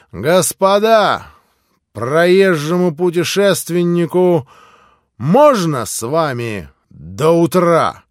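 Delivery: slow, loud gentlemanly request; Mitya's bold request. Acted. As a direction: grandly ask the gentlemen to join them. Mitya asks to join.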